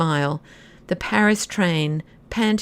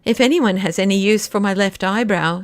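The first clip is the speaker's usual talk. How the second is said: ordinary delivery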